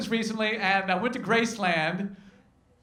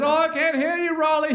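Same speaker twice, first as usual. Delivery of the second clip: a hint of [name]'s higher stage call; lightly imitating [name]; 8 semitones higher than usual. Comic imitating [name] calling to the crowd, lightly raised voice. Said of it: "When he says".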